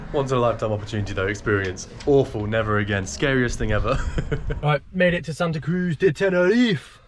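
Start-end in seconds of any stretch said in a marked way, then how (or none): 4.6-7.0 put-on a light French accent, a bit higher; playful hint of a French accent. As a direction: slip into French by accident. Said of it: "That was a bit of a French accent".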